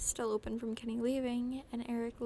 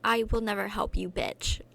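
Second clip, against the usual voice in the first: defiant snarl; 7 dB louder than usual. Acted.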